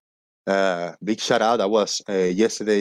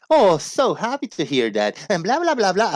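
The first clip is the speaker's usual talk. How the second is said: high, sing-song telemarketer imitation; scam-call recording; 9 semitones higher than usual. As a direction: mimic a perky automated sales call. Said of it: "it's a recording message".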